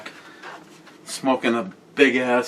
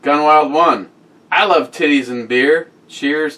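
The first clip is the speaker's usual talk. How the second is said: high, loud, hammed-up reading of the comment; loud excited reading; 6 semitones higher, 7 dB louder than usual. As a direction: read it out like a big announcement. Reading a fan comment enthusiastically.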